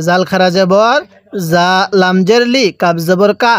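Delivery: normal talking voice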